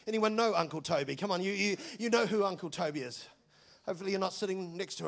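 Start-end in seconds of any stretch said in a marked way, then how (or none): none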